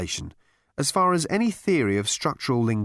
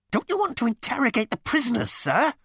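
high, quick squeal of a frustrated underling; 14 semitones higher than usual. A narrator's reading. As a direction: squeal it up high at your superior. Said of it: "he squealed".

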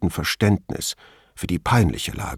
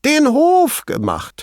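very high, drawn-out hooting cry; amused exclamation; 4 dB louder, slower than usual. A narrator's reading. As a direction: echo it even more incredulously, way up high. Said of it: "rief er". (sehr hoch und gedehnt ausgerufen)

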